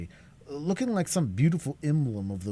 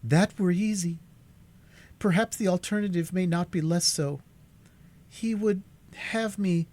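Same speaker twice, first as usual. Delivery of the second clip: calmer, firmer; 10 semitones higher, 3 dB louder than usual. A narrator's reading. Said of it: "with a calmer and firmer voice".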